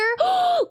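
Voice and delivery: sudden high gasp; sharp gasp. Real, not acted. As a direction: gasp in alarm. Sudden gasp at wolf spider.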